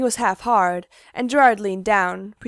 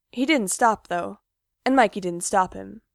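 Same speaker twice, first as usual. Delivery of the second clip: ordinary delivery, nothing marked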